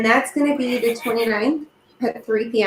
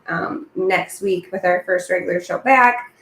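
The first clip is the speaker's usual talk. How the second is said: ordinary talk, nothing marked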